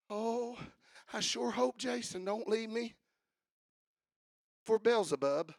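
small, soft, fretful mock voice; mock worried God. Preacher mocks the idea of a fretting God, soft and worried.

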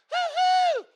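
imitating a high whooping call to friends; high hoo-hoo call. Kids calling friends out to play, high whooping hoo-hoo. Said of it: "we went".

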